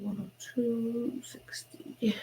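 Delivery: whispered numbers read to herself; whispering. Real, not acted. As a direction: whisper the figures under her breath.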